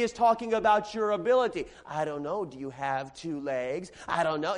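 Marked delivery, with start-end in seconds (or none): 1.8-4.5 low, soft mock-uncertain sarcasm; sarcastic mom. Mom questions the kid's ability to go outside, sarcastic. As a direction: feign doubt in a low, dry mother's voice. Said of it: "that's how moms can be sometimes".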